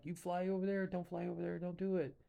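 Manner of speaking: high, urgent mock yell; retold yelling. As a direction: warn the pilot off, half-acted. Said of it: "he's screaming at Boris".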